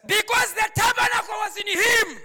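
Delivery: high, loud proclamation; preaching shout. Real, not acted. Preacher explaining David's confidence to the congregation, preaching shout. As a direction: drive the point home at full voice.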